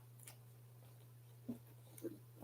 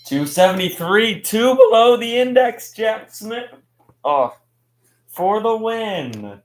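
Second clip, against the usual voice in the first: rising, louder, thrilled call; excited race call; 8 semitones higher, 7 dB louder than usual. Real, not acted. Live race call, winning pass.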